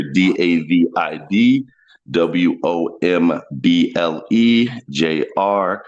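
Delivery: low, slow letter by letter; spelled out slowly. Real, not acted. Comedian spelling his Cash App name for the host, slow. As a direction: spell it clearly for them. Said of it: "[name]".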